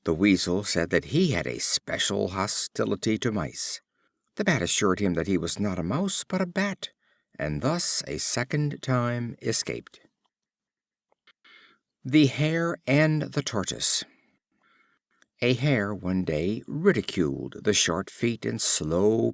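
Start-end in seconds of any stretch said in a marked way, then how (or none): none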